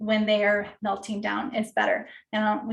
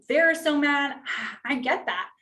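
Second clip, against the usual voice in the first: ordinary delivery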